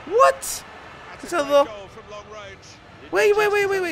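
high, loud, urgent repeats; squealed astonishment; stunned disbelief. Real, not acted. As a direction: blurt it out in real shock. Stunned, wants to see replay.

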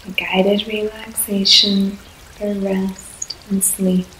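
ordinary talk

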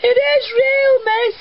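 put-on high, slow, wailing child's plea, nearly shouted; upset child's cry. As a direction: mimic a tearful little boy insisting. Quoting upset crying pupil.

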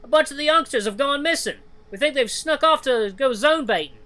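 high, loud, urgent news; alarmed report. Acted. Badger reporting missing youngsters, high urgent alarm. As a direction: raise the alarm, voice pitched right up.

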